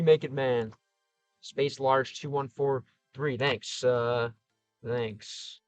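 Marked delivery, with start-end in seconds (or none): none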